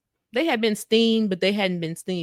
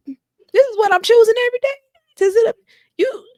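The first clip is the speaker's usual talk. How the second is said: high, loud mock outrage; exasperated rant; 8 dB louder than usual. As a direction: play a partner at the end of their patience. Acted rant at partner.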